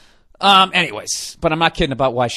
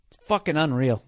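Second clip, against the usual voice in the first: usual speaking voice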